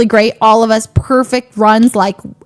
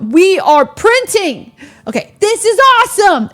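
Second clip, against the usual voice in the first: elated; 9 semitones higher, 4 dB louder than usual. Retold or imitated. Player printing money at the craps table, elated. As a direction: relive the winning streak at a high pitch. Retold shout while winning at craps.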